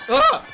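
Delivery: sharp high startled cry; startled yelp. Real, not acted. Streamer startled by a bird, sharp high yelp. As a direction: jump at the noise with a yelp.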